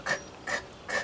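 quiet voiceless "k" clicks for K; soft, crisp phonics k; letter sound. Shown as a demonstration. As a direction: make only the k sound, three separate times. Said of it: "I wanna hear you say it".

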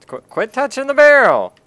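loud, high-pitched exasperated yell; stammered start into a shouted order. Real, not acted. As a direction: yell a warning at the player. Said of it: "Don't yell at me".

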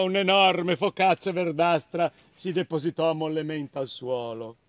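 ordinary delivery

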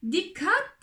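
high, sudden cry; warning shout. A narrator's reading. Spider lady warning the others, high shout. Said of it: "diye bağırdı örümcek hanım".

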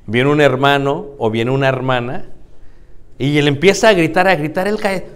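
normal talking voice

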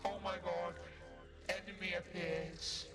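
usual speaking voice

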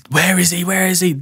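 lightly acted frantic questioning; mock urgent demand. Retold police rushing out, demanding.

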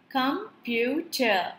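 "computer" slowly split as com, pu, ter; three separate beats on "computer"; syllable by syllable. Shown as a demonstration. Teacher showing a three-syllable word, "computer" split. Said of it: "divided into three chunks".

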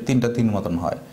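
normal talking voice

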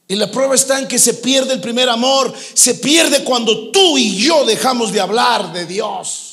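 loud, high-pitched sermon at full force; fervent preaching. Acted. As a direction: drive it home to the congregation.

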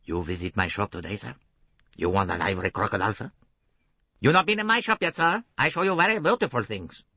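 smooth, coaxing merchant; a light touch of a coaxing shopkeeper; suave shopkeeper. A narrator's reading. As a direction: persuade the customer sweetly. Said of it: "Suave, persuasive accents here".

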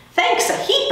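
high squeaky thanks; squeak. Acted. Hamster thanks the custodian for a carrot in a high squeak. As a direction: chirp it up high like a tiny hamster. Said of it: "I squeaked".

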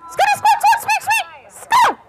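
urgent, very high squeaked command; shrieky mouse warning, very high and forceful; Mama Mouse squeaking. Acted. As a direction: squeak a sharp alarm to the children. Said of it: "Mama Mouse said".

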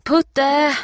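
high, loud, sustained call. A narrator's reading. Steffi searching for Putte, calling out. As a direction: call a lost dog from far away. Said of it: "ropar hon".

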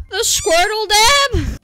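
high, slow put-on cartoon voice; cartoon Squirtle dab voice. Shown as a demonstration. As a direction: drag it out in a silly squeaky voice. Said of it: "don't ask me to do that again".

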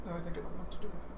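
ordinary talk

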